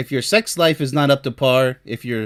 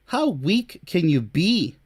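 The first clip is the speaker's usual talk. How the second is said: ordinary talk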